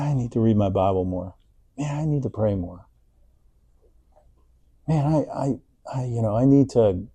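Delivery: soft, muttered inner voice; inner self-talk. Retold or imitated. Churchgoer thinking about his faith, soft and inward. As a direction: murmur it to yourself like a private worry. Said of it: "what do you think about".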